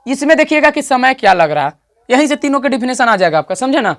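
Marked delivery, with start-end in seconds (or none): none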